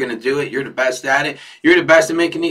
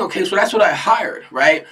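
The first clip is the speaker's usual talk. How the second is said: ordinary talk, nothing marked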